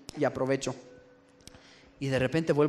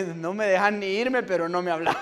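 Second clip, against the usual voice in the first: laughing complaint; 4 semitones higher, 5 dB louder than usual. Retold or imitated.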